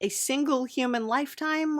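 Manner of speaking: ordinary talk, nothing marked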